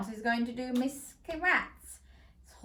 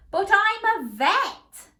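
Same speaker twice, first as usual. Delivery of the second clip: high, loud, slow protest; Doctor Chicken crying out; 8 semitones higher, 6 dB louder than usual. A narrator's reading. Doctor Chicken objecting to the cheese shop, loud. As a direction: plead it loudly like a fussed hen. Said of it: "cried Doctor Chicken".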